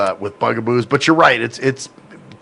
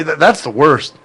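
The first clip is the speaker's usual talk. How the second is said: normal talking voice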